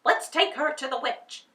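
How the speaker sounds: loud, rough order to haul her off; loud guards' command; gruff captor voice. A narrator's reading. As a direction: bark it like a guard. Captors taking Dorothy to witch.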